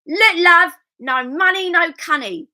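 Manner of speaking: loud, high Cockney harlot; Cockney accent. Shown as a demonstration. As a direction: bellow it like a Cockney barmaid. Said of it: "I'm trying to do Cockney now".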